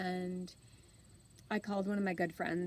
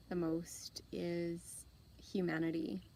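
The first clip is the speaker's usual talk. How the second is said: whisper; 3 dB quieter than usual. Real, not acted. Whispered reveal of her inspiration.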